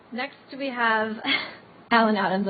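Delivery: real chuckle breaking into an introduction; laughing. Real, not acted. Host introducing the next speaker, amused by the bio, laughing. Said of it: "I'm sorry, I'm laughing".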